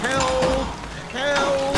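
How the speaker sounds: frantic, desperate calls for help; panicked yell; terrified cry for help. Acted. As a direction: scream for help as the barn shakes. Boy trapped, crying for help.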